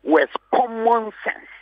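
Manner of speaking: common sense said slow and high; emphasis on common sense. Shown as a demonstration. Guest driving home common sense on air, slow emphatic. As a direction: stress every syllable of common sense. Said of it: "I'm placing emphasis on this".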